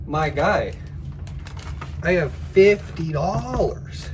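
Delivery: fed up and shouting at the scammer; exasperated yell. Retold or imitated. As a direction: say it like you've told him twice. Repeating the refusal, exasperated.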